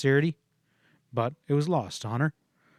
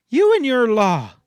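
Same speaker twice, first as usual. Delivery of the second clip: loud, much higher scornful cry; contemptuous outburst; 10 semitones higher, 9 dB louder than usual. A narrator's reading. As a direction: cut him down with scorn at full voice. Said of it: "she cut him down contemptuously".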